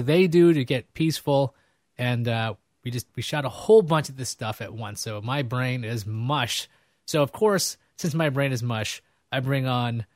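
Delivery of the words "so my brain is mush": slow and a little softer; slow, drained. Real, not acted. Host telling viewers his brain is mush, slow and drained. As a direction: let the words sag, worn out.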